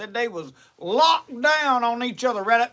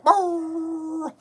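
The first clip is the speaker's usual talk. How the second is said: a long rolling trill like a hound on the trail; imitated hound baying, pitched up; 4 semitones higher than usual. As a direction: rattle the lips like a baying coon dog. Imitating coon dog on trail.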